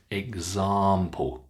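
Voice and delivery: "Example" said ig-zahm-ple, slowly; long ah. Shown as a demonstration. Teacher corrects a common mistake, long ah in "Example". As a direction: stretch the middle of "Example" to ah. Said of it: "students always get incorrect".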